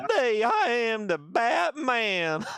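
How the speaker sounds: slow country drawl, amused; country accent. Acted. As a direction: drag it out like a hammed-up country Batman. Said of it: "Very country like".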